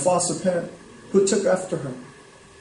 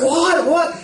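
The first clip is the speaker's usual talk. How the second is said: imitating a shocked crowd, much higher; a quick incredulous What? What?; a touch of hammed-up disbelief; mock shocked gasp; 9 semitones higher than usual. Congregation shocked at pastor's claim.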